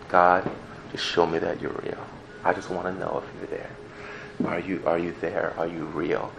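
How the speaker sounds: softly pleading prayer, barely voiced; quiet prayer. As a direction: pray it under your breath.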